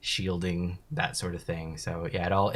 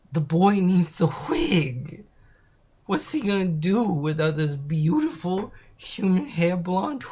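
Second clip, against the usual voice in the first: higher, slow, swooning over the wig; mock gushing; 7 semitones higher, slower than usual. Streamer gushing over a character's blonde wig, higher and slow. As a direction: gush like it's a treasure.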